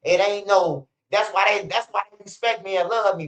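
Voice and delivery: usual speaking voice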